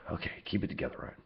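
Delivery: very soft, low aside to himself; sigh, then mutter under the breath; muttered self-talk. Real, not acted. Trainer telling himself to refocus, muttered low.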